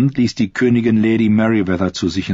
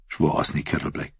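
ordinary delivery

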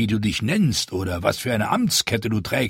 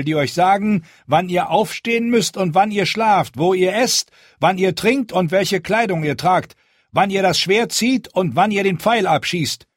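loud, high commanding lord; commanding speech; 10 semitones higher, 6 dB louder than usual. A narrator's reading. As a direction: address the crowd with stern authority. Jon's speech to the wildlings.

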